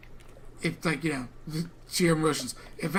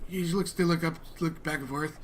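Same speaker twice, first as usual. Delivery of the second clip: usual speaking voice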